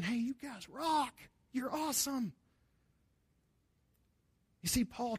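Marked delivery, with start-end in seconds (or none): 0.0-2.3 cheerful praise. Retold or imitated. Paul lifting them up.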